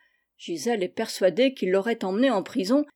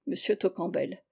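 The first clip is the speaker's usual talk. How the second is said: ordinary delivery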